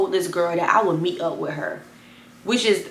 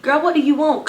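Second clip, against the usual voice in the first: higher, louder, fed up; annoyed phone answer; 7 semitones higher, 5 dB louder than usual. Retold or imitated. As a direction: pick up and snap. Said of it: "I'm like".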